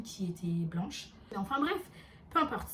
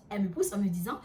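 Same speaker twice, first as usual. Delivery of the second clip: ordinary talk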